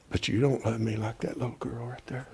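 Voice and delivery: hushed, breathy aside to Jimmy; whisper. Real, not acted. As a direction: lean in and whisper the tease. Teasing aside about loving a girl.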